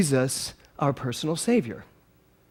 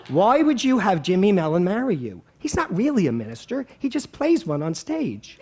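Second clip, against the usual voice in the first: put-on high, complaining Philly-accented voice; relative's Philly accent; 4 semitones higher than usual. Relative says he's no real minister, mocking Philly accent. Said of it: "In that accent".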